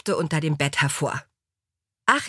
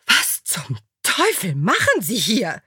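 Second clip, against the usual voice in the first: indignant, swooping demand; righteous wrath; 3 semitones higher than usual. A narrator's reading. Alexa confronting the intruder, indignant anger. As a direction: demand it in holy outrage. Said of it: "fragte Alexa von Baugenwitz mit heiligem Zorn".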